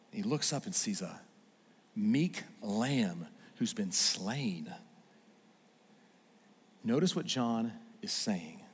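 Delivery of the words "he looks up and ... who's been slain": soft, slow, reverent; hushed reveal. Acted. Preacher reveals the slain lamb, soft and slow. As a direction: let the meek lamb land softly.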